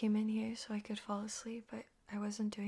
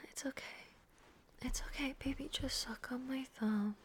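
soft, hushed reassurance; tender and quiet; soothing; 7 dB quieter than usual. Acted. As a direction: gently lull someone back to sleep. Soothing partner after a bad dream.

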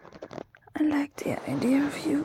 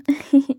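short laugh; 9 dB louder than usual. Real, not acted. Laugh before goodbye.

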